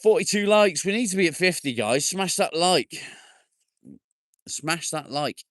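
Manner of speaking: high, loud, pumped-up plea; rally the viewers, all energy; hyped call for likes. Real, not acted. Streamer urging viewers to reach fifty likes, high excited.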